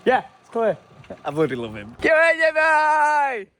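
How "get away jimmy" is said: loud, high playful call. Real, not acted. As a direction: shout after him. Calling after departing friend.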